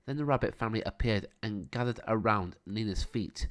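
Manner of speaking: plain reading voice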